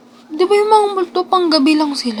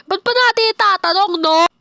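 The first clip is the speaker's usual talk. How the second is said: excited outburst; 6 semitones higher than usual. Acted. Startled mid-game outburst.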